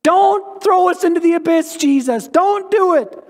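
high, hammed-up demon voice begging; pleading demons.

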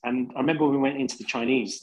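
ordinary talk, nothing marked

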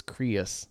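"Creus" stretched into two syllables, CREE-us; drawn-out Southern "Creus" for Chris. Shown as a demonstration. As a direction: break "Creus" in two, Southern hometown style. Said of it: "my name becomes two syllables".

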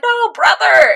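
high, loud plea to a brother; imitating an anguished shout; desperate cry. Acts Al's desperate cry to brother.

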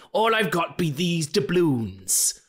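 slow hammed-up pirate brogue; pirate Seamus. Seamus offering doubloons to the bank teller, pirate brogue. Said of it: "he's a pirate every time".

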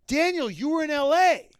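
high, loud incredulous outburst; exasperated inner rant. Retold or imitated. Host venting at Daniel's dumb question, high and loud. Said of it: "I'm thinking to myself".

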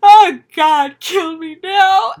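loud, high, laughing wail of doom; hammed-up despair, laughing; laughing mock despair.

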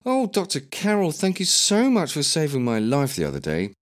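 high, breathless admiring voice; gushing grateful patient. Pretend. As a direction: gush with exaggerated gratitude.

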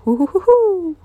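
high bouncy hoo-hoo laugh; hammed-up high Tigger hoo-hoo laugh. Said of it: "He laughed a Tigger laugh".

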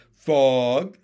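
one loud, drawn-out word; loud, simple froggy pronouncement; loud proclamation. A narrator's reading. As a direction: declare it like an official verdict. Pin proclaims to another froglet.

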